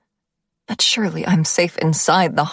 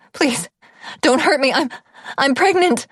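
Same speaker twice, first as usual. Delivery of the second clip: terrified pleading; 8 semitones higher than usual. A narrator's reading. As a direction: beg for mercy in panic. Begging the gunman.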